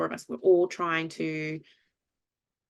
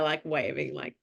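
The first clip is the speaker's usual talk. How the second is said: usual speaking voice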